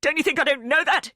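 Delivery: furious yell down the phone. Acted. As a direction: explode at him. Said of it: "Neil yells".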